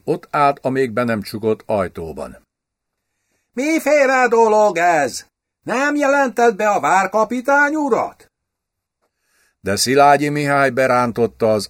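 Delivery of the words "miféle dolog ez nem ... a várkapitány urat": high-pitched, affected outburst at a servant; számonkérő rákiáltás. A narrator's reading. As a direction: call out indignantly in a much higher voice. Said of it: "németül rákiáltott az ajtón álló lakályra". (magas hangú, felháborodott rákiáltás)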